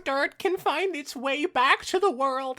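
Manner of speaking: high, loud, snarling villain; evil Nightmare Moon voice. Acted. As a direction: roar it like a furious moon villain. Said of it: "I gotta do an evil voice for this".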